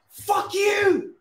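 high, drawn-out angry scream; enraged high-pitched yell; real furious shout. Real, not acted. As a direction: scream abuse at the player. Furious at player's miss.

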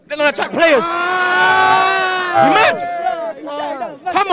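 angry shout. Real, not acted. Fan yelling at the host about signings, angry shout. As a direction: blast it at the interviewer, fed up.

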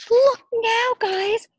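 ordinary delivery

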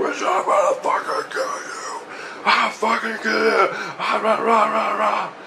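high, growling mock rage; hammed-up angry roar, breaking into rah-rah; imitated yelling. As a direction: rant wordlessly in a high raging voice. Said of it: "he's yelling at me like this".

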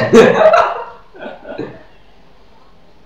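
high burst of laughter; loud laugh. Real, not acted. Laughing at joke.

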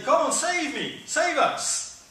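high, loud taunting challenge; mocking. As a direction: sneer it up at the sky. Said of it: "mocking him".

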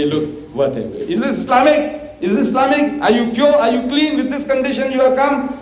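rising hammed-up challenge to the Arabs; mock challenge. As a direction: challenge them, pitch climbing.

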